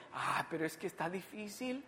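soft, reluctant objection; doubting believer. Acted. Preacher voicing believers who find it hard, doubting. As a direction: sigh out a hushed, doubtful excuse. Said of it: "muchos de nosotros decimos".

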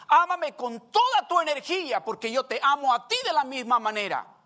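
building from steady to loud, high preaching; voicing God's plea, rising to a loud cry; full-force preaching.

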